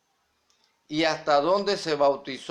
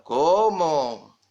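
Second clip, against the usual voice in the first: drawn-out, louder mock "¿Cómo?"; imitating stunned reaction; 5 dB louder than usual. Preacher mimicking the silent congregation's reaction, stunned. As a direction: echo the congregation's disbelief, stretched out. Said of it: "El resto se quedó callado".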